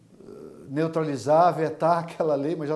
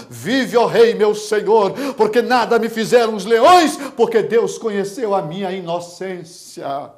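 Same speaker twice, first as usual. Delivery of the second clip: loud, raised preacher's cry; shouted reply; 7 semitones higher, 12 dB louder than usual. Acted. Daniel answering the king from the pit, shouting. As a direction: shout it up, easing at the end. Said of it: "ele gritou de lá de dentro da cova".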